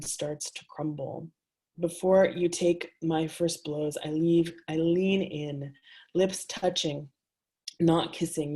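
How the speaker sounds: measured, intimate poetry reading; reading her own words to a live audience; reading aloud. A narrator's reading. Author reading her erotic prose to an audience, intimate and measured.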